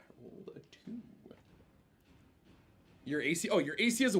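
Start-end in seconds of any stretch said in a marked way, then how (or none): 0.0-1.0 whispering. Real, not acted. DM aside about dice.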